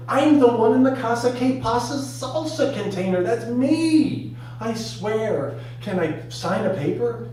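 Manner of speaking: high, loud frantic insistence; desperate protest. Acted. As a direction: plead your case, starting loud and easing into begging. Desperately pleading with hospital caller.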